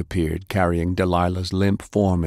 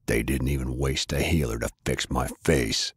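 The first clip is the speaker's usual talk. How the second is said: a touch lower, cold and bitter; quiet gloating, barely lower; lightly voiced menacing brother; 6 semitones lower, 5 dB quieter than usual. A narrator's reading. Brother taunting his brother over their past, low and bitter.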